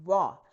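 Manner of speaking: वा with lips rounded like Urdu vao, a clear w; वा as English w, rounded lips. Shown as a demonstration. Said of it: "गोल करते हैं".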